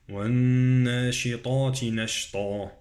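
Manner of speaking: long nasal doubled nun in "والناشطات"; recite, humming the nun of "والناشطات", heavy ta; ghunnah on the nun. Shown as a demonstration. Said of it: "النون غنة، نون مشددة".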